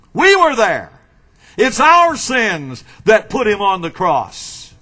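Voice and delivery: impassioned pulpit delivery, quick at first, then emphatic; forceful preaching. Acted. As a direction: press the charge home to the congregation.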